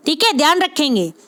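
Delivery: usual speaking voice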